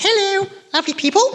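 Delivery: very high mock Queen's English accent; hammed-up royal accent, high-pitched. Shown as a demonstration. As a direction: falsetto impression of the Queen's accent. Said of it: "Now for my Queen's accent".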